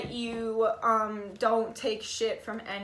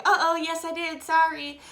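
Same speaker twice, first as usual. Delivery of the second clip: a lightly voiced caught-out partner, higher and louder; sheepish apology; 6 semitones higher, 5 dB louder than usual. Partner caught saying something dumb, sheepish mock apology. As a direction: own up like you got caught.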